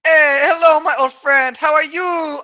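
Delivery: high, loud, cheery hail; calling out. Acted. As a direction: shout a drawn-out hello to an old friend. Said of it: "I called out to him".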